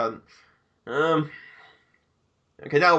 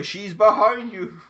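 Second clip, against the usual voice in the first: mock warning shout; 5 semitones higher, 5 dB louder, slower than usual. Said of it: "I wanted to go".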